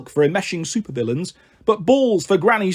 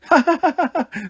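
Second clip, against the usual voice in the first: high-pitched acted giggle; ticklish laughter; 9 semitones higher than usual. A narrator's reading. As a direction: laugh as if being helplessly tickled. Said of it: "tried to stop laughing".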